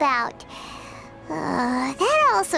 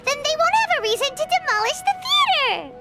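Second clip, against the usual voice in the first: higher, faster, excited finish; excited brainwave; 5 semitones higher, faster than usual. Acted. Companion pitches a plan to save the theater, excitedly. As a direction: race triumphantly to the conclusion.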